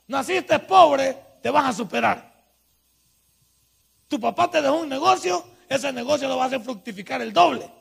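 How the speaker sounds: loud, shouting preacher; preaching at full force. Acted. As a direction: rouse them with each line, near a shout.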